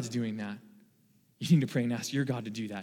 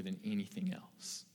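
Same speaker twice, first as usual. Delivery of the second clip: hushed testimony; 4 semitones lower, 8 dB quieter than usual. Real, not acted. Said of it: "I can testify to you".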